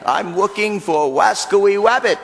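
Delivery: Wascoey Wabbit with w in place of r, slowly; says w for r. Retold or imitated. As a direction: say Wabbit with a w instead of r. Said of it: "he can't pronounce his R's".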